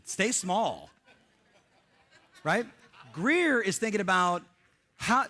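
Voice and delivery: usual speaking voice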